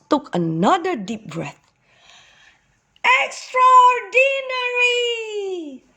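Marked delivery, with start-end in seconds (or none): 3.2-5.8 high, loud, slow delighted shout; a boy shouting to make an echo. Acted. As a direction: shout the word into the cave. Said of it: "shouted X Eddie Ox".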